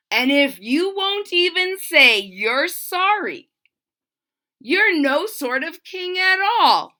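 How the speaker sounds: put-on defiant boy. A narrator's reading. Boy telling the king off to his face. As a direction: stand up to the king.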